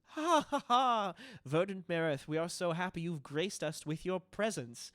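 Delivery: high, loud, theatrical laugh and greeting; gushing hostess. Acted. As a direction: gush over the guest with a big laugh. GM voices Franny greeting Marith.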